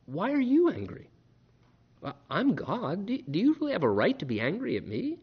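God gently asks, soft and high, then slowing down; loving and subtle. Retold or imitated. Preacher voicing God gently questioning the congregation, soft and tender. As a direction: ask softly, like a loving father reasoning. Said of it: "lovingly and subtly says to us".

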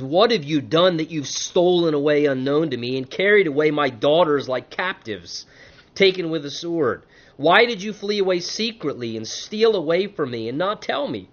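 ordinary delivery

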